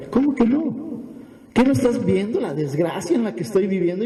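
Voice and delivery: acted protest, starting high; mildly indignant retort. Sufferers answering the hopeful prophet, mildly indignant. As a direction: object in mock disbelief. Voices people objecting to the prophet.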